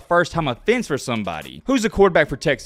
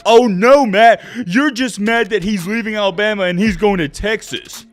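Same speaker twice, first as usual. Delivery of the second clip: mocking critics; 3 semitones higher, 4 dB louder than usual. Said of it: "all y'all said was".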